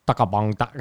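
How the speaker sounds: made-up Thai-sounding syllables; mock Thai accent. Shown as a demonstration. Comic showing what Thai was like, mock Thai accent. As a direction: imitate the sound of Thai speech. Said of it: "Thai was more like".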